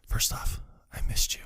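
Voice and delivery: barely voiced, breathy hush; whisper. Partner confessing in a hush under the bed, whispered.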